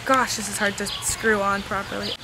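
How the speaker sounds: ordinary talk, nothing marked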